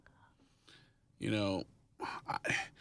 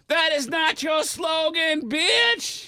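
high, slow, furious shout; 5 dB louder, much slower than usual. Retold or imitated. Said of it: "that guy is like".

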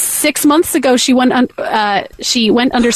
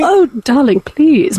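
put-on British accent; much slower than usual. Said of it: "Do you want some crumpets".

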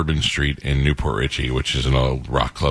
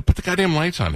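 retold angry demand; 13 semitones higher than usual. Angry showgoer confronts the guy about the dark stage, high-pitched retold demand. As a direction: act out being mad without really shouting. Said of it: "I got mad".

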